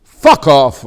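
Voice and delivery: a sudden loud fuck off. Shown as a demonstration. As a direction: blast the fuck off out. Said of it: "you can say fuck".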